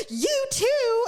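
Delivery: husband cheerfully answering the rude guy; cheery reply. Retold or imitated. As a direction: answer as if nothing happened.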